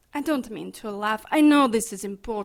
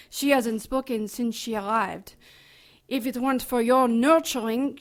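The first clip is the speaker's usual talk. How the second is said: ordinary talk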